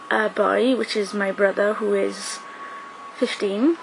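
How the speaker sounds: normal talking voice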